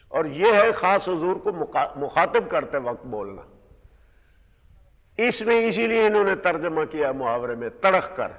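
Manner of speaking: ordinary delivery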